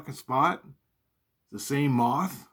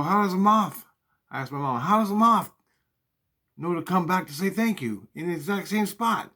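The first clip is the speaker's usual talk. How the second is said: pitched-up disbelief, pressing mom for an answer; incredulous higher questioning; 7 semitones higher, 4 dB louder than usual. Retold or imitated. Son pressing his mom about the moth, incredulous and raised. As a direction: demand to know how it happened.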